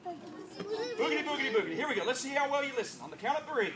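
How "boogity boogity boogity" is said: put-on kids party magician. Shown as a demonstration. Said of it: "what do you say?".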